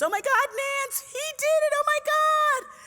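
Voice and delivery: very high, loud imitation of a screaming girl; excited scream. Thirteen-year-old neighbor calling about the win, screaming with excitement. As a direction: squeal it at full pitch, drawing out the end. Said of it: "She screams".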